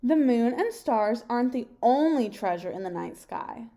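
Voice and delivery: ordinary delivery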